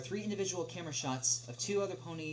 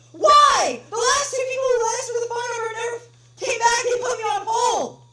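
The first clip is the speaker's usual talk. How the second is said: furious caller yelling into the phone, much higher and louder; angry shout; 17 dB louder than usual. Acted. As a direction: scream your grievance at the clerk. Angry caller yells, wrong take.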